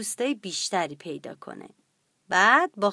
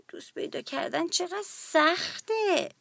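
soft, higher-pitched musing aloud; talking to self, upset; 7 semitones higher, 5 dB quieter than usual. Acted. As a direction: sigh it softly to yourself, pitched up. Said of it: "با خودش گفت". (آهسته و ناراحت، با خود)

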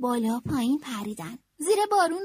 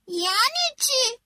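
higher, slower puzzled bear voice; 9 semitones higher, 4 dB louder, slower than usual. A narrator's reading. Bear asking Franklin what he means, a puzzled, higher voice. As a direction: ask it, really not understanding. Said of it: "خرس پرسید".

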